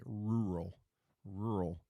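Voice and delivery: "Rural" sounded out carefully as two syllables, ROO-rul; careful pronunciation. Shown as a demonstration. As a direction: work through "Rural" as a hard word to say. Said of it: "found that word really hard to say".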